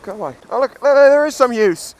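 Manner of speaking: ordinary delivery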